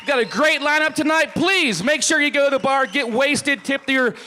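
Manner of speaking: booming crowd-hype sign-off; loud hype sign-off. Real, not acted. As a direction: pump up the crowd like a host. Loud sign-off, show host hype.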